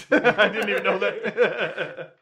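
slow words through laughter; laughing. Real, not acted. Laughs while surprised.

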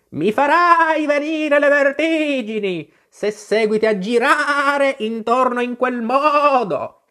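high, slow, bleating-long vowels, loud; an exasperated sheep stretching out every stressed vowel; scolding sheep. Acted. The sheep scolds Alice for spinning round, high drawn-out scolding.

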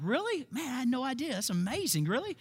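high, sarcastic fake surprise; mock amazement. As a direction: play Jesus pretending to be impressed. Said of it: "And Jesus is like".

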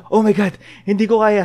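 lightly voiced panicked friend, out of breath; a hint of a breathless friend in a panic. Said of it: "sabi niya".

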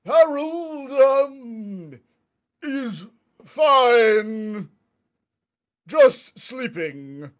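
loud, slow heavy troll growl; ogre voice. Acted. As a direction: lumber through it like a dim ogre. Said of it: "trying to do his best ogre voice".

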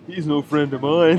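loud, slow, put-on character voice; quoted sitcom line. Quoting Father Ted episode line.

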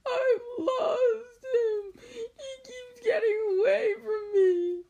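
high, loud, long-drawn wail. Acted. Tearful boy wails over escaping toad, high slow wailing. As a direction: wail it out slowly, hammed-up sobbing voice. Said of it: "he wailed".

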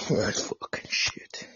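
slow, hushed cursing under the breath; whispered swearing. Real, not acted. Podcaster fuming alone before the stream, whispered curse. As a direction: hiss the curse to yourself.

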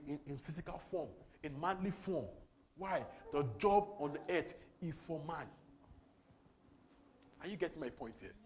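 ordinary talk

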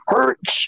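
a high, strained "Hurch!" grunt; mock effort grunt. Friend retells the push with a high mock grunt. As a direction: bark out a high strangled grunt as you shove. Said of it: "pushes him up against the wall and goes".

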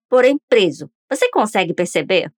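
usual speaking voice

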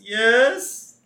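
high, drawn-out answer; Homer. Retold or imitated. Host as Homer answering the reverend, calling back high. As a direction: call back with a long rising yes.

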